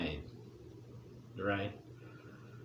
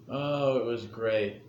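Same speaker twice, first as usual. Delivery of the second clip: ordinary talk, nothing marked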